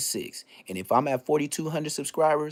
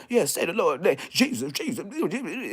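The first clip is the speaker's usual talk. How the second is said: church exorcists; 7 semitones higher than usual. Retold or imitated. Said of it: "they was like".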